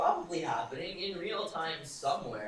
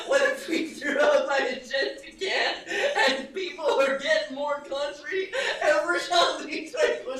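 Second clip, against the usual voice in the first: high, loud hammed-up alarm through laughter; mock panic; 11 semitones higher, 9 dB louder than usual. Streamer playing at horror over a lost enchanted can, high and shouting.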